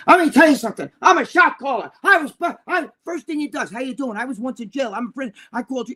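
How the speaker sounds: high-pitched boastful prison-tough parody; mock fake badass. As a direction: mimic a self-styled shot caller showing off. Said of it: "a true fucking badass is not gonna go on there".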